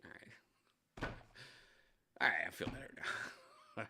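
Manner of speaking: hushed whisper to himself; self-soothing whisper after a scare. Real, not acted. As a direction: whisper it to settle your nerves. Calming himself after freaking out.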